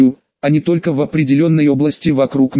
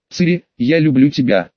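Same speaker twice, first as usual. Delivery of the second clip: ordinary delivery, nothing marked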